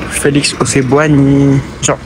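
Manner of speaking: usual speaking voice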